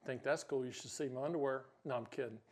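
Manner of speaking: low, soft mutter; joking aside. Real, not acted. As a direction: toss it away under your breath. Muttered joking aside about shirts.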